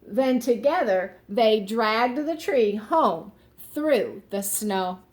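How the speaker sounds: usual speaking voice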